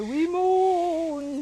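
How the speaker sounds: loud, eerie moan; mummies moaning. A narrator's reading. Little mummies answering mother, loud moan. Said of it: "said the five".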